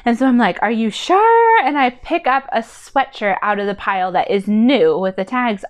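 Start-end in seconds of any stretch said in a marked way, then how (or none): 0.6-1.6 high, slow, drawn-out question; doubtful mom. Retold or imitated. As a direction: ask it slowly and high, full of doubt. Mom questioning son's sweatshirt purge.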